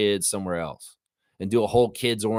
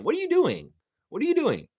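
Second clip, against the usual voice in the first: way higher, pleading in disbelief; incredulous falsetto asking what they're doing; exasperated high demand. Real, not acted. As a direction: repeat it in squeaky outrage. Ranting at Raptors admin.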